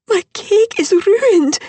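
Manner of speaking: high, loud tearful cry over the ruined cake; distraught wail. Acted. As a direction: wail it like a heartbroken child.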